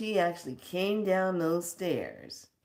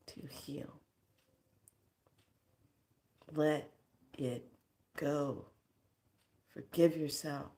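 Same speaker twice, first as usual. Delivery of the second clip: tender elder; 6 dB quieter than usual. Retold or imitated. Elder comforting her in a hug.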